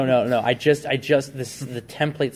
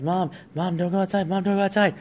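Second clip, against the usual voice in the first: put-on high, mildly panicked boy's voice; pleading kid; 8 semitones higher than usual. Son begging his mother not to go outside, high mild panic. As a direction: beg her, a hint of a scared child.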